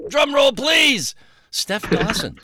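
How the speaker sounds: high, drawn-out showman's call; big, playful build-up to a reveal; mock announcer call. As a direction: call out grandly for a drum roll. Announcing the new member.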